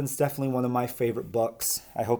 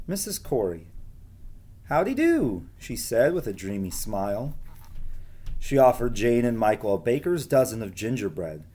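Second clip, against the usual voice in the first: gentle storybook reading voice; reading to children. Acted. Teacher reading a storybook aloud to her pupils, gentle. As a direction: read it like a bedtime story to the class.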